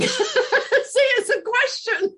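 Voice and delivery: high, slow and laughing through the words; laughing, mock disciple. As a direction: giggle it out as a playful disciple. Laughing while voicing the disciples.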